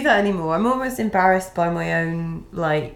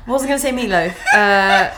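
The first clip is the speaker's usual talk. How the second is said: laughing shout; 4 semitones higher, 5 dB louder than usual. Real, not acted. Laughing outburst at Meatloaf.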